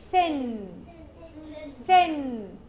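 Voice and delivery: "thin" drawn out with a voiceless TH and falling tune; soft TH. Shown as a demonstration. Teacher asking learners to repeat, "thin" with soft TH. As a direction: model "thin" clearly, soft TH at the start. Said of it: "Repeat after me".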